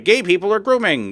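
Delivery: high mocking voice of a bigot; mock bigot quote. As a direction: sneer it like a ranting homophobe. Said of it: "when people are like".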